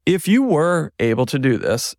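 heavy emphasis on "were"; "were" stressed heavily as a big if. Real, not acted. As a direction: lean hard on the word "were" to flag the condition. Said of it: "were is very heavily emphasized there".

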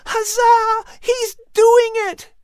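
very high, loud whoop; jubilant boy. Acted. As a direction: cheer wildly, stretching the words. Boy dances, cheering the eagle.

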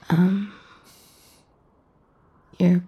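normal talking voice

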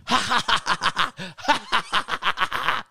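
high-pitched mock guffaw; roommate's hysterical cackle, acted out; imitated burst of laughter. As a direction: roar out a long imitation laugh. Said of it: "He bust out laughing".

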